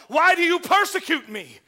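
a divine voice asking why; voice from heaven. Acted. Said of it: "I heard a voice saying unto me".

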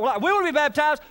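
high, fast, eager voice; mock eager crowd. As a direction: sound keen and naive. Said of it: "Some people come out".

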